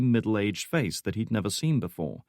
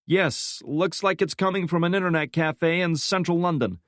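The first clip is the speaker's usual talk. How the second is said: lightly voiced young analyst, higher; young analyst Santiago; 9 semitones higher than usual. A narrator's reading. Young analyst reporting to his boss, lightly higher voice. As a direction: report it briskly, eyes on screen.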